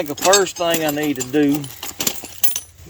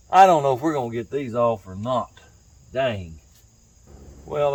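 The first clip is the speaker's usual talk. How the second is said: normal talking voice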